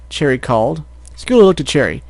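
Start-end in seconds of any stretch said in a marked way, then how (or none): none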